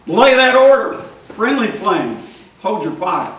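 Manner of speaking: put-on officer overruling a drunk, loud then fading; loud order. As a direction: snap the order back, then ease off.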